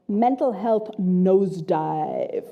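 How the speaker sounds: usual speaking voice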